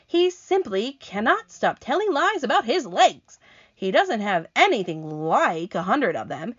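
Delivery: high, loud, grumbling earthworm; indignant earthworm. Acted. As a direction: set the record straight, offended. Earthworm voiced in story.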